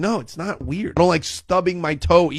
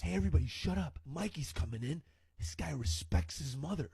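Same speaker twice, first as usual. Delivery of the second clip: hushed bully; 5 semitones lower, 13 dB quieter than usual. Acted. Bully hushes class for Mikey.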